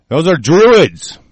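loud, high, drawn-out outburst; real emphatic shout. Real, not acted. Host ranting about Bohemian Grove to listeners, emphatic shout. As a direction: shout it slowly, pitch leaping up.

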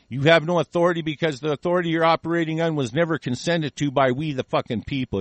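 ordinary talk

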